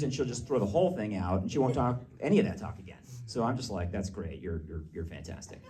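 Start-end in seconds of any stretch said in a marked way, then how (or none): 3.9-5.6 low, soft, hesitant encouragement; careful praise. Retold or imitated. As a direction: tiptoe through the compliment, very softly. Tiptoeing praise so she keeps talking.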